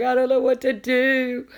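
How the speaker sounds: retelling her skunk panic in a high mock wail; mock bawling. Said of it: "I started bawling. I was like".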